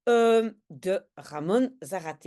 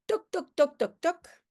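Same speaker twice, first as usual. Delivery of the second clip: high-pitched spoken door knocks; knocking imitation; 7 semitones higher than usual. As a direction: knock with your voice, toc-toc. Frappe à la porte dans l'histoire. (toc-toc aigu qui imite des coups à la porte)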